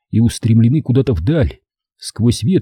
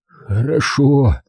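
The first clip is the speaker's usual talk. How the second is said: breathed out; 3 semitones higher, much slower than usual. A narrator's reading. Dying father accepts his son's oath, exhaling it weakly. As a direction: let it out on a long sigh of relief. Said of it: "выдохнул отец".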